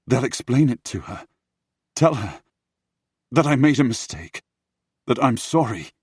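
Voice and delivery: high, loud, desperate instructions; urgent pleading. Acted. Prince begging brothers to explain to Olivia, urgent pleading.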